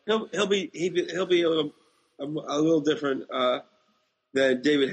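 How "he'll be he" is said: ordinary talk, nothing marked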